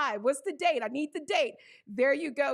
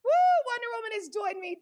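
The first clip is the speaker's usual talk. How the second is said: excited cheer; 7 semitones higher, 4 dB louder than usual. Real, not acted. Cheering a viewer's arrival.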